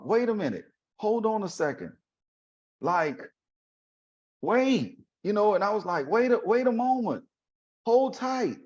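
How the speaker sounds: high-pitched, halting objection; retold protest. He halts her orders.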